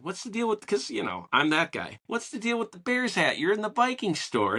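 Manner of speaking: high, needling mock challenge to a stranger. Fan teasing a stranger in a Bears hat, needling challenge. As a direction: tease him like a know-it-all.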